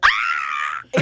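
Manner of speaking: sudden low yelp of shock; startled scream. Retold or imitated. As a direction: let out a startled shriek. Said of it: "I'm like".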